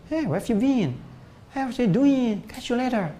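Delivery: high-pitched put-on native English accent; chirpy imitation of English-accented small talk; English accent impression. Shown as a demonstration. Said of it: "Bahasa Inggris kayak orang Inggris".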